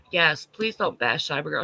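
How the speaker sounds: usual speaking voice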